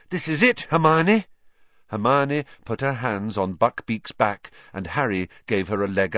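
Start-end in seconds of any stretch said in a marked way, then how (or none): 0.1-1.2 urgent. A narrator's reading. Harry urging as executioner comes.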